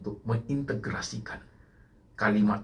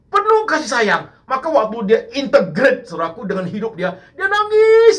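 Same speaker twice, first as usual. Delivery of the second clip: much higher and louder, near wailing; impassioned preaching; 13 semitones higher, 10 dB louder than usual. Acted. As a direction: cry out Peter's weeping to the church. Emotional peak on Peter weeping.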